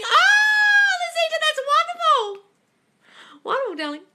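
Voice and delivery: very high, loud drawn-out cry of joy, slow; ecstatic shout. Real, not acted. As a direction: shout for joy, stretching the words. Ecstatic reaction to thriving relationship.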